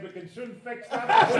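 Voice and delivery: high, loud heckle from the back. Retold or imitated. Imitating an audience heckler's loud reply to the machine gun line. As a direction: call it out like an audience member. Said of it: "voice in the back said".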